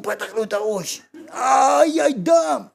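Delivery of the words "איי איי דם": high, loud cry of pain; pained shout. Acted. Passerby struck by the stone cries out, high and loud. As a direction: yell out as the stone hits.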